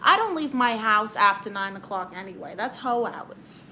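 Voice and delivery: ordinary talk, nothing marked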